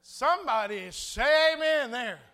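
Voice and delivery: loud, slow preacher's call; calling for amen. Real, not acted. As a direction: belt it out to rouse the congregation. Calls congregation for amen.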